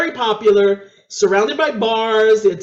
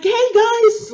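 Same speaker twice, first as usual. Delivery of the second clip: high, sing-out mock-cheery hello; acted high-pitched friendly hail; chirpy greeting; 13 semitones higher than usual. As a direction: call out brightly to familiar regulars. Repeats acted greeting to cops.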